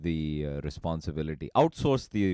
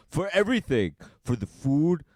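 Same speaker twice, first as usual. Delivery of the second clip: raised and louder, lecturing; a hammed-up uncle lecture, louder; stern uncle; 10 semitones higher, 6 dB louder than usual. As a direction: bark out everything he owes. Uncle answering the kid.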